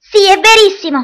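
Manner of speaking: angry shout. Acted. Girl shouting back at her brother, insisting. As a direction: yell it right back. Children quarrelling at breakfast.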